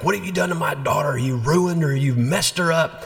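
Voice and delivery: retold angry rant, pitched high; mad father. Irate father phoning the bishop about his daughter, mock angry. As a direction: imitate an irate dad, high and quick. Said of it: "He was mad, so mad".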